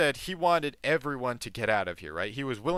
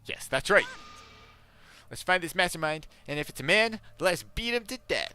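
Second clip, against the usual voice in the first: lightly voiced determined character, pitched up; rally the group with raised pitch; resolute game heroine; 7 semitones higher than usual.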